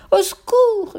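very high, loud shriek; piercing cry for help; screamed for help. A narrator's reading. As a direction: scream for rescue in panic. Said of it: "hurla la mère".

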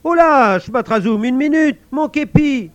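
high loud cry; guard crying out. Acted. Guard shouting as the wind carries him off, high and loud. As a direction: yell after the lost cap.

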